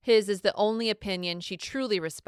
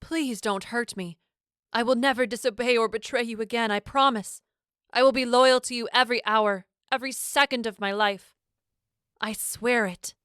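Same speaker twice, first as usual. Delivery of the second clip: desperate pleading; 5 semitones higher, 3 dB louder than usual. Acted. Captive pleads for her life.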